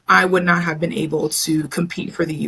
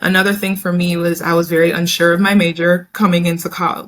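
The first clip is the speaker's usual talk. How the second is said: usual speaking voice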